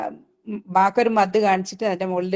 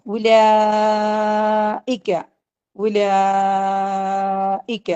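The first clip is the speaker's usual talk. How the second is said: very slow, long drawn chant; slow tajweed chant; Quran recitation; much slower than usual. Shown as a demonstration. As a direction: stretch the word out like recitation. Teacher recites the word for class.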